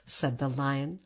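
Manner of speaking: usual speaking voice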